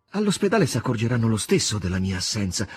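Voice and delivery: usual speaking voice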